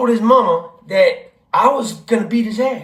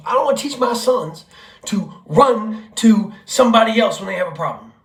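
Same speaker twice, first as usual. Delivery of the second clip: usual speaking voice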